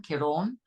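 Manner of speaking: Ciarán with a long AWN ending; corrected pronunciation. Shown as a demonstration. Presenter demonstrating the storm name, Ciarán as kee-RAWN. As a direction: rhyme the end of Ciarán with yawn. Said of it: "a bit like a yawn".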